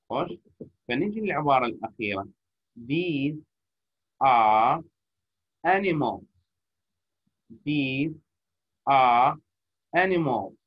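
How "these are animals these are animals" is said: each word apart, are punched louder. Shown as a demonstration. Teacher demonstrating choppy reading to students. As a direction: chop it into single words. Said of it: "جزأتها أنا كل كلمة قريتها".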